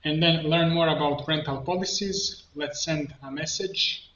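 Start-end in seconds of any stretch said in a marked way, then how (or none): none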